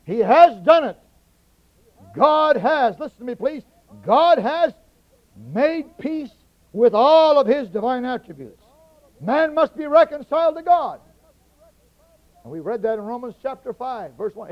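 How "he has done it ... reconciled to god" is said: loud, ringing shout on each phrase; preaching at full force. Acted. Preacher to congregation, shouting that God has done it. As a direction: hammer each claim like a revival preacher.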